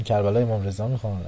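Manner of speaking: usual speaking voice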